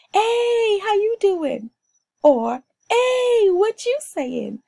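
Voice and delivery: high, loud Bahamian hail across the street; hailing a friend. Retold or imitated. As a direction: call out to a friend, big and bright. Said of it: "when we want to hail a friend".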